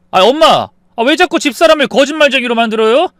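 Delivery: high, loud complaint; exasperated protest. Acted. Son defending wife from liar accusation, exasperated protest. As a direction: argue back in exasperation.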